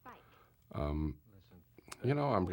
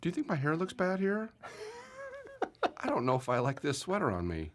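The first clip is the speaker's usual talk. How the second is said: higher, primping and self-conscious; vain actress voice; 12 semitones higher than usual. Pretend. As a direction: fuss like a vain starlet. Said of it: "that's what she might be saying".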